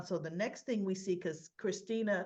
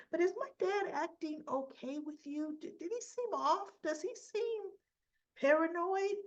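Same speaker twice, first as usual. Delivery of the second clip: put-on higher, worried girl's voice; anxious Christina voice; 8 semitones higher than usual. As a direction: ask it like a fretful daughter.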